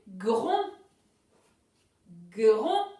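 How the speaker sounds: "gron" loud, gr stretched out, rising at the end; dictated syllable. Shown as a demonstration. Teacher dictates the next syllable to pupils, loud and drawn out. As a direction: boom out "gron" slowly as a dictation word. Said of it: "La prochaine, c'est".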